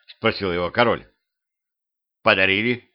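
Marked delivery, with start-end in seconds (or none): none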